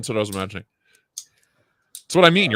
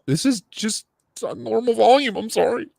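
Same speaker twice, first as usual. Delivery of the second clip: mock defensive; 10 semitones higher than usual. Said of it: "And I'm like".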